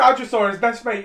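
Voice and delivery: sudden loud surprise; excited. Real, not acted. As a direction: blurt it out, thrilled. Spots friend on TV, excited.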